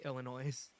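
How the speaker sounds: "Illinois" with a sounded s at the end; mispronunciation. Retold or imitated. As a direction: say "Illinois" so it rhymes with "noise". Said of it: "correct people who say Illinois".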